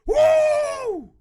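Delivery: very high, loud whooping yell. Acted. Reader cheering the imagined charge, wild whoop. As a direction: let out a wild victory woo. Victory whoop after hype.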